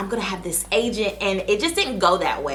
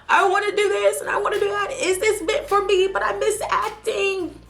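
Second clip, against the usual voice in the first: much higher, fast whimpering complaint; mock whiny crying; 14 semitones higher than usual. Retelling her crying spell to viewers, high mock whine. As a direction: whine it like a crying kid, hammed-up. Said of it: "I was crying, boo-hoo crying".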